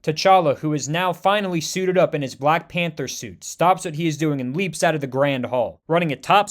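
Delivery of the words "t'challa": ordinary delivery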